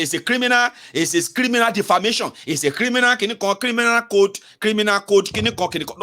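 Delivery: high, insistent mock accusation; mocking police impression. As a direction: parrot the police's repeated charge, pitched high. Mimicking police shouting criminal accusations.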